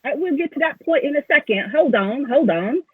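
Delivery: lightly voiced halfling asking for patience; halfling recruiter. Halfling puts off player's question.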